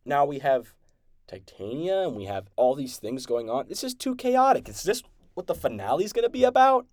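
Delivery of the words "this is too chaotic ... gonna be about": much higher, frantic mock exasperation; She-Hulk breaking the fourth wall, hammed-up frantic exasperation; exasperated complaint. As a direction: voice a heroine fed up with the chaos. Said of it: "she's like".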